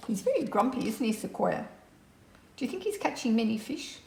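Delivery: ordinary delivery, nothing marked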